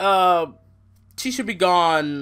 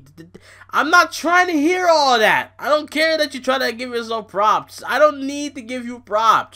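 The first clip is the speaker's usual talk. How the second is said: higher, louder, fed-up ranting; exasperated rant; 9 semitones higher, 6 dB louder than usual. Real, not acted. To Stephanie bragging on Raw, a higher, louder exasperated rant. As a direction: shout her down, completely fed up.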